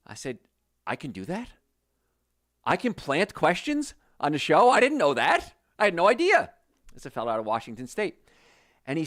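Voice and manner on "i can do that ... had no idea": amazed disbelief. Retold or imitated. Retold surprised reply to radio guy.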